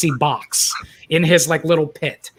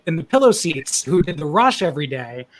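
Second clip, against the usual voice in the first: usual speaking voice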